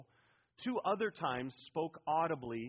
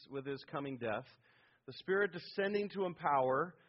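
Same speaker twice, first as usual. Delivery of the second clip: ordinary talk, nothing marked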